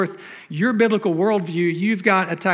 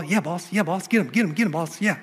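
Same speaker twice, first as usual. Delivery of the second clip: eager little sidekick dog voice; yappy chihuahua sidekick. Retold or imitated. Pastor voicing Tobiah as the cartoon bulldog's chihuahua, lightly yappy and eager. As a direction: egg the boss on like a cartoon chihuahua. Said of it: "the little chihuahua".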